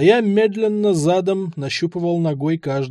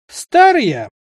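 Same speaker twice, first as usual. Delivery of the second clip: put-on higher and louder old man's cry; old man exclaiming; 10 semitones higher, 8 dB louder than usual. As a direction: let the old man proclaim his age.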